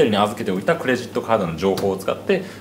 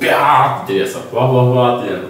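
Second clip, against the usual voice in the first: mock shower gush; 7 dB louder than usual. Imitating shower water noise.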